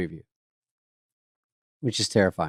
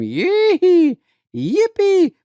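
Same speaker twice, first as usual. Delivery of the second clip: high, loud hammed-up yelps; rowdy yee-haw; 7 dB louder, much slower than usual. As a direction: yelp like a cowboy at a rodeo. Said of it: "Every time they see him".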